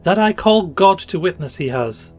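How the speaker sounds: high, loud bitter oath; passionate. A narrator's reading. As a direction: swear it with passion. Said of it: "said the woman passionately".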